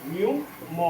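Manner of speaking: ordinary talk, nothing marked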